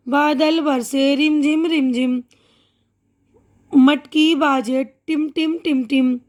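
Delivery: put-on slow, sing-song rhyme for children; poem recital. Shown as a demonstration. Teacher reciting a rain poem to young pupils, slow sing-song. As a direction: recite the poem slowly, with drawn-out sound words.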